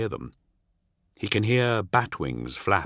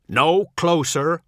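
loud, raised warning; slow, high warning call; 8 semitones higher, 6 dB louder than usual. A narrator's reading. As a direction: call it out high and firm, drawn out. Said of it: "Ol calls out".

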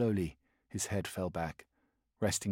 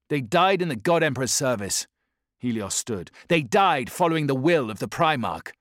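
higher, louder, firm conviction; stern chaplain; 5 semitones higher, 7 dB louder than usual. Acted. Helios defending the Primarch's will to his squad, firm. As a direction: preach duty to the grieving brothers.

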